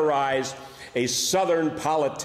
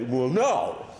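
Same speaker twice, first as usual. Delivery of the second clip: Jack Benny voice. Retold or imitated. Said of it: "do you know that you talk like Jack Benny".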